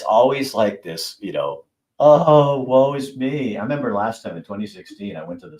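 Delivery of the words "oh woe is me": mock woe. Mocking upset voters.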